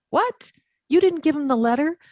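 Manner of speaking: high, disbelieving question as she retells it; mock shock. Retold shocked reply to client.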